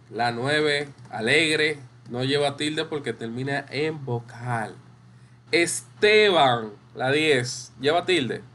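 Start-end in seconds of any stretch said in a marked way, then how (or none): 5.5-6.7 "Esteban" drawn out, es-TEE-ban, stress on TE; slow dictation of "Esteban" with a long TE; sounded out slowly. Shown as a demonstration. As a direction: stretch "Esteban" slowly, leaning on TE. Teacher sounds out name for class.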